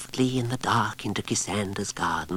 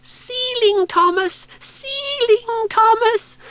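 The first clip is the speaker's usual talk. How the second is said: much higher, slow, breathy squeak; mouse's whispered call to the spider; mouse calling softly; 9 dB louder, slower than usual. Acted. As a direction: hiss a secret signal up the tree. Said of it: "So instead he called out".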